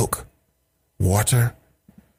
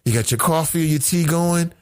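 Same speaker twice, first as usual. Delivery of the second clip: usual speaking voice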